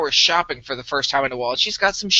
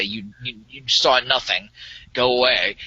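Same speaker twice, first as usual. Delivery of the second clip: Jedi mind trick; 4 semitones lower than usual. Retold or imitated. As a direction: wave it away like a mind trick. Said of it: "uses mind trick to basically say".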